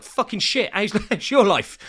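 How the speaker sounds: hammed-up bitter answer thrown back; mock blunt reply. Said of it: "I'd be like".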